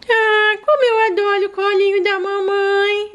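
put-on high childlike voice of a small daughter; little girl voice. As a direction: play the sick little girl talking to mom. Daughter doll in mom's lap.

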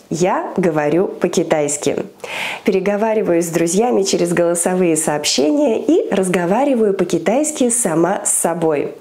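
ordinary talk, nothing marked

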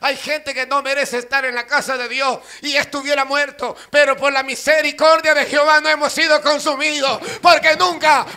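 loud, high sermon building to a shout; full-force preaching. Acted. Preacher declaring God's mercy never fails, shouted preaching. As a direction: climb in volume, pounding the repeated words.

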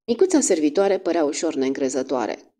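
ordinary delivery

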